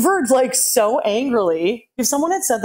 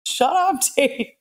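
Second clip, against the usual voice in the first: high, laughing shut up; playful protest; 7 semitones higher than usual. Real, not acted. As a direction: protest playfully to a teasing friend. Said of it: "teasing friend".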